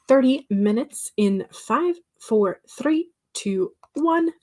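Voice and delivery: rising countdown. Real, not acted. Countdown to start sprint.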